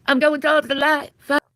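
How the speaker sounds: high airy ghost floating away; mock ghost voice. Streamer playing a dying ghost, high and airy. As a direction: drift off toward the light as a ghost.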